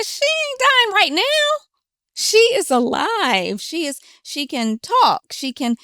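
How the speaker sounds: high-pitched, emphatic pushback, stretched out; indignant retort. Retold or imitated. Correcting a relative who fears seeing her dying, indignant. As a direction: insist on it, word by word, pitch thrown up. Acted retort to family member.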